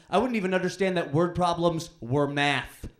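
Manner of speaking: normal talking voice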